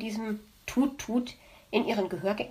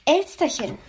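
mock fox voice; 5 semitones higher, 4 dB louder than usual. Magpie mimics the fox teasing her, mocking. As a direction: purr Elsterchen like the smug fox. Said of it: "wird er dann sagen".

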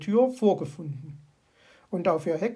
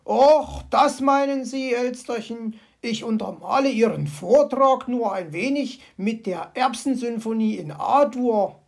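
louder, cheerful fox voice; amused fox; 3 semitones higher, 6 dB louder than usual. Acted. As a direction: explain with a chuckle. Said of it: "erklärte er lachend".

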